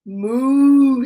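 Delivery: long drawn-out moo; cow moo. Acted. Storyteller voicing a cow, long moo. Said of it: "says a cow".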